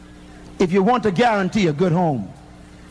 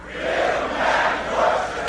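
whispered repeat; 3 dB quieter, much slower than usual. Shown as a demonstration. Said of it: "One more time".

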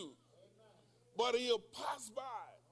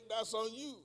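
ordinary talk

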